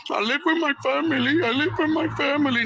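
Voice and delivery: high-pitched mocking mimicry; mocking imitation. Angry father mocking the other man's excuse, high mimicking voice.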